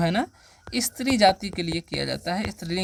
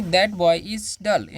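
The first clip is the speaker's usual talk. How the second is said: put-on "That boy is dull" said very slowly, word by word; slow teacher dictation; 3 dB louder, much slower than usual. Shown as a demonstration. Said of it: "जैसे बोलेंगे".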